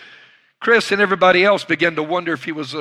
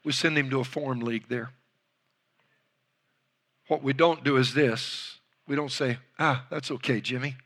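much softer, serious words; soft, grave telling; 3 semitones lower, 8 dB quieter than usual. Retold or imitated. Old coach tells the room, softly. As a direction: lower the voice to draw them in. Solemn point in the coach's speech.

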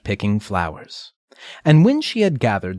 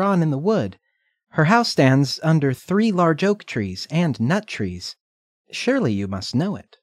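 put-on higher, innocent little girl; 6 semitones higher than usual. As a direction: chirp it like a trusting child. Redcap voiced by narrator.

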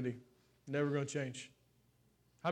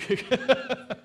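high chuckling burst; laugh; 6 semitones higher, 4 dB louder than usual. Real, not acted. Preacher amused while finding something for his nose, laughing. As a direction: break into a real laugh.

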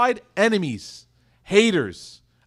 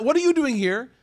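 serious questioning; 7 semitones higher than usual. Acted. Enemies catching each other a third night, serious questioning. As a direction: demand an explanation from a rival. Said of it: "this is a very serious question".

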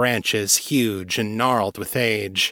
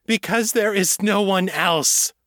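rising desperate cry; 9 semitones higher than usual. A narrator's reading. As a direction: voice climbing in anger and desperation. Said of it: "voice rose in pitch and volume".

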